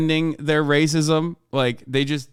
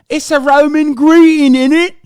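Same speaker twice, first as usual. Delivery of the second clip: higher, louder British lad accent, mocking a fascist; British accent, sneering with fake outrage; 11 semitones higher, 9 dB louder than usual. Streamer mocking a far-right Brit in a British accent. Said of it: "Why are you so good with that accent?".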